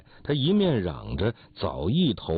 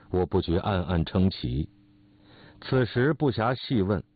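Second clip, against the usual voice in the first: ordinary delivery, nothing marked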